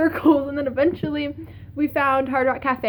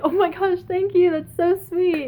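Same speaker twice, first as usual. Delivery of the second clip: retold sweet, higher-voiced gushing; gushing thanks; 5 semitones higher than usual. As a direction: act out being touched by a gift. Band member thanks her for gift.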